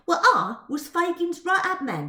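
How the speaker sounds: put-on loud, high street-boy swagger; Dodger's cockney voice. Narrator voicing Dodger boasting to Twill, loud cockney brag. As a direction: boast like a London pickpocket. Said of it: "declared Dodger".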